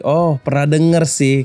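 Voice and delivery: ordinary talk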